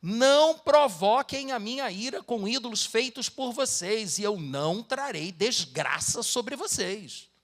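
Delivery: dramatic Bible reading; solemn scripture reading. Acted. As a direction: proclaim the verses with weight. Reads scripture aloud.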